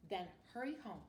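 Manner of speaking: ordinary talk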